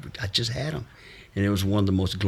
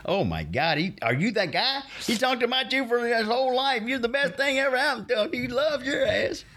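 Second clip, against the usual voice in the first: excited grandson. Retold or imitated. Grandson's joyful reaction, retold.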